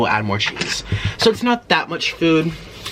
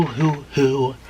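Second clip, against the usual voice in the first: ordinary talk, nothing marked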